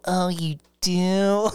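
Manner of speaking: put-on slow, drawn-out Southern Valley girl voice. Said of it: "she's doing her voice like this".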